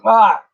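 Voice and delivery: real yelp at the shock of the water; sharp gasp. Real, not acted. Stepping into cold water, a sudden loud yelp.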